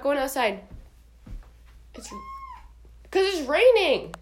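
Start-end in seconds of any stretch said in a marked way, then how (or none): 1.9-4.1 higher, stretched-out explanation; drawn-out insistence. Real, not acted. Owner telling the dog they're staying in, drawn out. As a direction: spell it out slowly for the dog.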